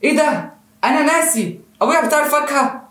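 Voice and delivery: high-pitched shocked exclamation; astonished realisation. Acted. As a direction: act out stunned, sudden recognition. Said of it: "فيبدأ يبص له باستغراب كده".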